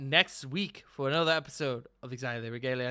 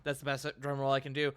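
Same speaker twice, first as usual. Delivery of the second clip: usual speaking voice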